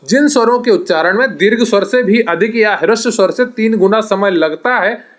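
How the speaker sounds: usual speaking voice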